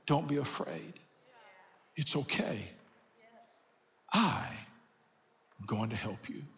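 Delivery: soft, near-whispered promise; whispered comfort. Acted. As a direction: breathe it into his ear. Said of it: "hear him whisper in your ear".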